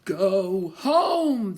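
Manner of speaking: a hint of a howling wind; squalling wind. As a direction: moan it like a storm gust. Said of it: "the wind squalled".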